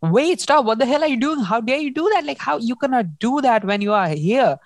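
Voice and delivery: half-acted outrage, stop her now; alarmed protest. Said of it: "And he's like".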